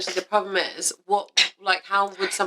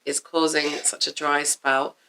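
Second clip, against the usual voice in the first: stilted reading aloud; 2 semitones lower than usual. Real, not acted. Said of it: "it's the way you've read it".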